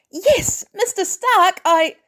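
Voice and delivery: put-on high, loud, eager teen voice; excited teen Peter. Peter cheering to Mister Stark after snatching fabric, high and eager. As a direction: cheer it out like a thrilled kid.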